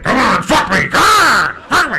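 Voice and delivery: very loud, high mock yell; crazed demanding shout. Comic imitates crazed woman demanding sex, shouting wildly. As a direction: holler it like a crazed lover. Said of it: "She was crazy. She was like".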